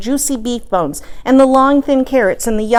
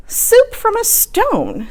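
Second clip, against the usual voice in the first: slow, much higher voice with wonder; old lady voice; 13 semitones higher, much slower than usual. Acted. Little old lady marvelling at stone soup, slow and high.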